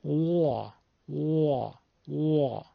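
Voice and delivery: soft imitated pigeon coo; quiet dove call. Shown as a demonstration. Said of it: "صدای کبوتر اگه دقت بکنید".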